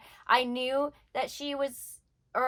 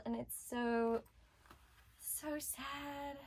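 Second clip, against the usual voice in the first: hushed sadness; 12 dB quieter, much slower than usual. Real, not acted. Moved by the hospital scene.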